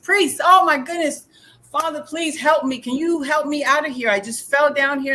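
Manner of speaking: acted high cry for help; yelling up. Trapped man to a passing priest, yelling up. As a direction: call up for rescue, hammed-up. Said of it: "he suddenly yells up to the priest".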